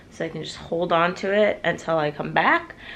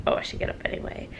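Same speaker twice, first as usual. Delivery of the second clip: whispered quick aside to self; sleepy whispered thought; 10 dB quieter, faster than usual. Retold or imitated. Quoting herself being woken up.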